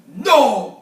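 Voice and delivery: sudden loud gasped "No!"; a startled rhino gasps no. A narrator's reading. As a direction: blurt it out in shock. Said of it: "gasped the rhinoceros".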